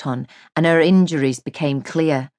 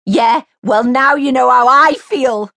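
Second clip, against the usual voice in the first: bitter, louder and higher throw-back; spat retort; 9 semitones higher, 7 dB louder than usual. A narrator's reading. Mary hitting back at Jay, spitting. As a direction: spit the words back at him. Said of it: "spat Mary".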